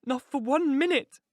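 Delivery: high, slow refusal; desperate, drawn-out refusal; adamant woman. Acted. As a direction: refuse it emphatically, pitched high. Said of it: "she replied".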